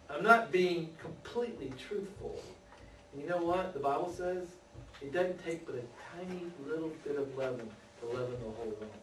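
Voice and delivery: usual speaking voice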